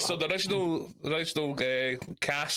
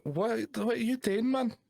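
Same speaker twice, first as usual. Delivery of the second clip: higher pitched mock wife demanding what he's doing; 9 semitones higher than usual. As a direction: imitate a wife catching him mid-voice, a touch exasperated. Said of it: "go like".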